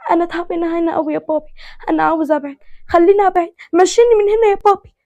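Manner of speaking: put-on a hurt girl clinging to her dad; pleading daughter. Daughter begging to leave. (بنت تترجى أبوها بصوت عالي باكي)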